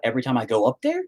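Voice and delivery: ordinary talk, nothing marked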